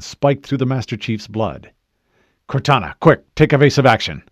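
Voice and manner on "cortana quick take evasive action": loud, raised, urgent order; urgent command. Acted. Master Chief ordering Cortana to act fast, urgent shout. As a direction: call out an emergency order.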